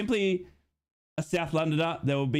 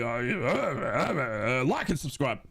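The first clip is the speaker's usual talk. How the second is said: mock stalling; 3 semitones lower, slower than usual. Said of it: "But I'm normally".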